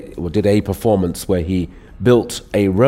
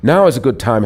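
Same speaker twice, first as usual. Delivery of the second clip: ordinary talk, nothing marked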